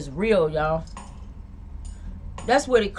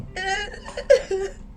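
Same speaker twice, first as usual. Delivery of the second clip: high-pitched sobs; short burst of tearful sobbing; real crying; 10 semitones higher than usual. Real, not acted. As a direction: sob helplessly without words. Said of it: "I'm starting to cry right now".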